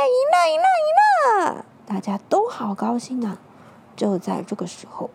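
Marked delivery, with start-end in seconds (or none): 0.1-1.7 high, loud victory cheer. Acted. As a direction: cheer the win. Crowd celebrating victory.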